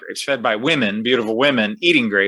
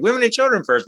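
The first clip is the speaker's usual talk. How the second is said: high mock voice; 11 semitones higher, faster than usual. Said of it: "that's what you say".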